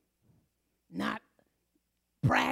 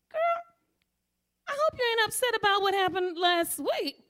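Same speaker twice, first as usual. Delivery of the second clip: bright high greeting; 10 semitones higher, 4 dB louder than usual. Retold or imitated. Calling out to coworker.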